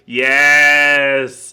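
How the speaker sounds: long loud drawn-out yes; a big, loud, drawn-out yes; excited yes. Real, not acted. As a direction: stretch the yes out loud with glee. Host excited at the flag.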